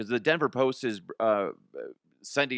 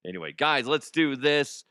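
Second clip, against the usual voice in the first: usual speaking voice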